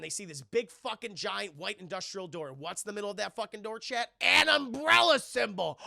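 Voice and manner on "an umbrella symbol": slow, loud, high reveal; dramatic reveal. Real, not acted. Streamer revealing the twist to chat, slow and loud. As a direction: land it like a plot twist.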